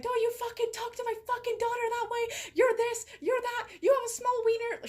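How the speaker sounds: high, fast shrieking mom impression; hammed-up raging mom, high and fast; mock furious mom. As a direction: shriek it like an enraged mother, way up high. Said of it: "She didn't say it that way".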